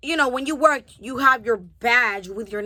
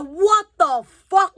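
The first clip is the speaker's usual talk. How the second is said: louder, higher, drawn-out exasperation; ticked off; 7 semitones higher, 5 dB louder, much slower than usual. Retold or imitated. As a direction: retell it with stretched, incredulous outrage. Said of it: "that just took me off".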